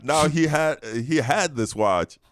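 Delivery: high, drawn-out mocking voice. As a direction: keep the silly high voice going. Continuing the mock voice bit.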